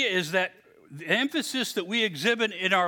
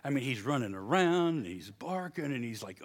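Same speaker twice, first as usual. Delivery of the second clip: normal talking voice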